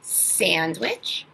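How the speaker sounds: normal talking voice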